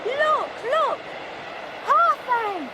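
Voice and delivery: loud, high, thrilled cry; excited shout. Acted. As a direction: shout with relief at the sight. Spotting the castle at last.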